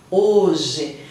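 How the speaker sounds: hoje said without any h sound, as oje; silent h. Shown as a demonstration. Teacher demonstrates hoje for students, silent h. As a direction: show that the h in hoje makes no sound. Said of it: "Na hora de falar o hoje".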